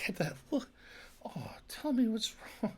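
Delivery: whispered, tender TV dad; sitcom dad whisper. Pretend. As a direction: hush it like a caring sitcom father.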